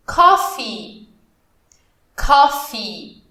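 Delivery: "Coffee" with a strong, clear final "ee"; strong final sound. Shown as a demonstration. Teacher models "Coffee" to students, strong final vowel. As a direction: model "Coffee" with a firm ending. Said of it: "fazer o coffee bem forte nesse final".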